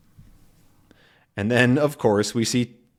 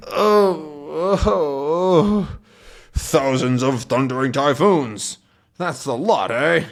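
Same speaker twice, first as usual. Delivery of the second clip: pained captain; 3 semitones higher, slower than usual. Acted. Captain hit on the head by coconuts, crying out in pain. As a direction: moan in pain under falling coconuts.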